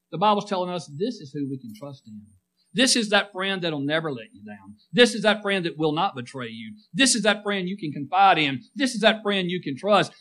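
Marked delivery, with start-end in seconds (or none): none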